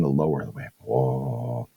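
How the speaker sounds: very soft, low, fading voice; low energy. Shown as a demonstration. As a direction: let the voice sink down quietly. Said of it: "The less energy I put in".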